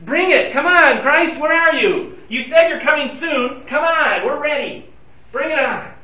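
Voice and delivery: high, loud, urgent calling out; impatient. Acted. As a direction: demand it like an impatient crowd. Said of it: "We're impatient".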